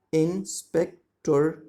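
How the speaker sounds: "Inspector" very slowly in three separate beats; split into syllables. Shown as a demonstration. Teacher counts three syllables, "Inspector" slowly broken into in-spec-tor. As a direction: stretch "Inspector" out as in-spec-tor, pausing between parts. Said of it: "these are three syllable".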